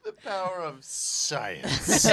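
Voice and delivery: slow, grand mock proclamation; dramatic announcer. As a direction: draw it out like a movie trailer.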